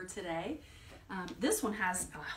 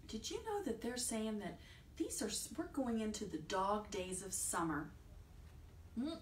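ordinary delivery

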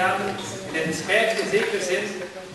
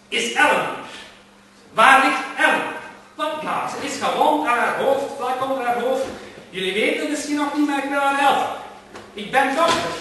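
higher, insistent questioning; urgent pleading; 6 semitones higher, 3 dB louder than usual. Acted. Gentleman coaxing the plants to reveal Ellen, urgent. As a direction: press them to tell you where she lies.

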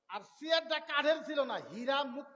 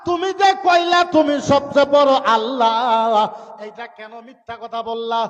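wailing accusation; 17 dB louder, slower than usual. Acted. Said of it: "কানতে কানতে আসছে".

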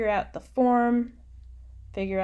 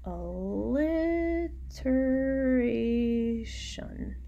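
drawn-out word; 5 semitones higher, much slower than usual. Shown as a demonstration. Teacher drawing out the term.

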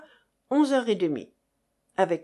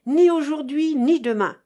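shouting; 4 semitones higher, 6 dB louder than usual. Acted. Continues the cry.

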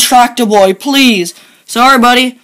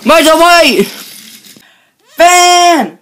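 yelling; 6 semitones higher, much slower than usual. Acted. Friend yells dramatically although his buddy is right there. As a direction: call across as if your friend were far off. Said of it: "you don't have to yell".

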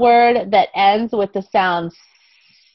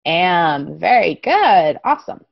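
ordinary talk, nothing marked

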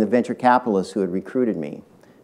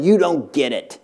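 "you don't get it" pitched higher with a heated stress on "you"; angry retort; 4 semitones higher than usual. Retold or imitated. Co-founder scolding the new CEO, higher and heated. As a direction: snap "you don't get it" high, leaning on "you". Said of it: "got up quite angry and said".

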